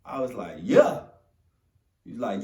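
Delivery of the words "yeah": eager yes. Retold or imitated. Answering mentor eagerly.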